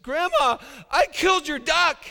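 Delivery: put-on high, loud blurted child confession; cracking confession. Guilty Johnny finally blurting his confession to grandma, high loud. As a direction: burst out like a kid who can't hold it in. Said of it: "he just cracked, finally confessed".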